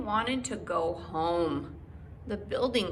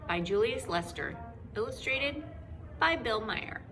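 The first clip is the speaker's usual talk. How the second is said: usual speaking voice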